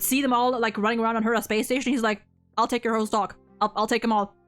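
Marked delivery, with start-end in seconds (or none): none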